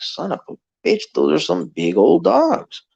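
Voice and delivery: retold wide-eyed amazement; amazed reaction. Stunned at sight of huge dogs.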